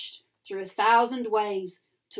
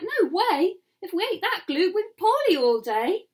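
put-on little boy voice; 8 semitones higher, 4 dB louder than usual. A narrator's reading. Little boy's voice.

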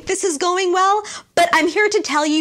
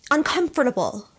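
normal talking voice

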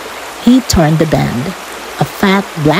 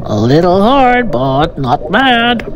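satisfied fish; 3 semitones higher than usual. Acted. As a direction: give a leisurely verdict on the meal. Fish judges the swallowed soldier.

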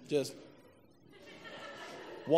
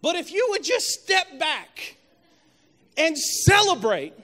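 loud, high, slow preacher's cry; full-force preaching; 11 semitones higher, 8 dB louder, slower than usual. Acted. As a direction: stretch the rebuke out at a preacher's shout.

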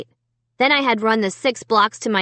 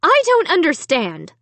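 high, upset exclamation; alarmed mother, pitch raised; distressed mother's cry; 7 semitones higher than usual. A narrator's reading. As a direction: cry it out in bewilderment. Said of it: "Alicia's mother cried".

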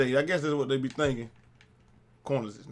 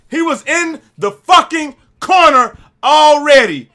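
slow, loud furious shout; angry yell; 12 semitones higher, 15 dB louder, slower than usual. Real, not acted. Gamer raging about an enemy camping a corner. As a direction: bellow it out in disbelief.

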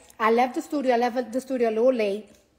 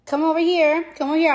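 insistent call; 6 semitones higher, 6 dB louder than usual. Real, not acted. Calling friend over, insistent.

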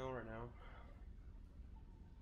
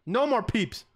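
teacher shout; 10 semitones higher, 11 dB louder, slower than usual. Pretend. Repeats the teacher warning.

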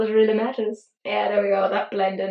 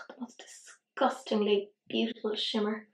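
softer, whispered admiration; awed whisper; 6 dB quieter than usual. Real, not acted. Vlogger whispering awe at the shimmer on her lid. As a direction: breathe it out in awe.

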